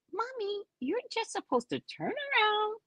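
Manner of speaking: high, soft acted plea to mom; small high-pitched coaxing; high hushed appeal. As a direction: plead quietly up in a high voice.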